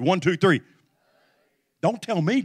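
usual speaking voice

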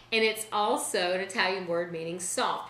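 ordinary talk, nothing marked

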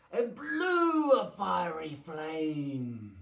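loud, stretched-out storytelling; big slow dramatic narration; dramatic climax. A narrator's reading.

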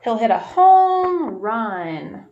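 loud, slow and drawn-out triumph; excited home run. A narrator's reading. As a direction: stretch it out like the ball is flying far. Batting a home run in story.

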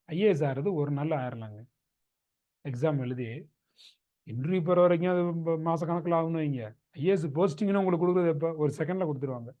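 ordinary talk

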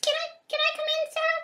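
high, fast squeaky little voice; tiny creature voice. Acted. Little mouse asks the host to come in, high and squeaky. Animal character asks to enter.

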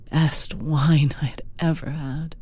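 usual speaking voice

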